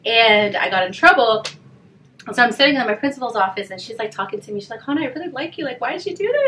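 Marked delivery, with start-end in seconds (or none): 4.8-6.5 imitated principal, high and soft; lightly voiced, pleading school principal; gently disappointed principal. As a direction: softly reproach a student you like, pitched up. Principal gently confronting her, retold.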